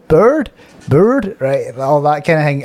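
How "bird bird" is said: "bird" with a rounded "ur" vowel, pitched higher; imitating a visitor testing "bird"; trying out "bird". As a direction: repeat "bird" experimentally in a non-local way. Echoing the visitor trying out the word.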